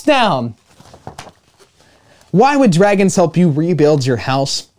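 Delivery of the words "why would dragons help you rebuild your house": ordinary delivery, nothing marked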